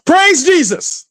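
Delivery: high ecstatic church cry; hammed-up joyful worshipper; mock praise. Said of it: "and go".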